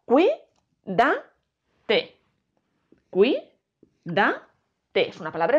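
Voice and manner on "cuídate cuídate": "Cuídate" broken into separate syllables with long pauses; "Cuídate" in detached, spaced-out beats. Shown as a demonstration. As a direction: dictate "Cuídate" one syllable at a time. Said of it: "Separamos en sílabas".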